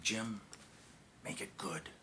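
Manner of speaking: soft, halting words in confidence; president's confiding quote. Retold or imitated. Retelling the president's private warning to him, hushed. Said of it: "He said".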